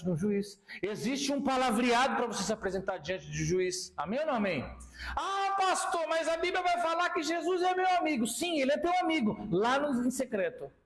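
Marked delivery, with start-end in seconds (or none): 5.1-8.3 a higher, lightly voiced believer protesting; light mock voice of a protesting believer; objecting believer. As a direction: mimic a churchgoer's protest.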